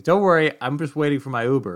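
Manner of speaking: loud, high reassuring call. Retold or imitated. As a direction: yell across to them, keen not to be left. Timothy calls out across parking lot.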